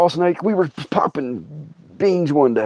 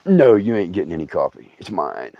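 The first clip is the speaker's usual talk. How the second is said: low snake voice, sinking lower; possessive snake; 10 semitones lower, 3 dB quieter than usual. Retold or imitated. Snake guarding coffee from its owner, possessive low voice. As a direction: play a snake laying claim, low and final.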